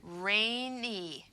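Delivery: rainy drawn out slowly; rainy blended slowly; slow blending. Shown as a demonstration. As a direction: glide through rainy slowly. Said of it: "two-syllable word with two vowel sounds".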